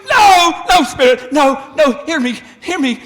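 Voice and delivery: loud, high, terrified cries of no; desperate pleading. Acted. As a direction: beg the spirit for mercy at full voice. Scrooge begs the spirit.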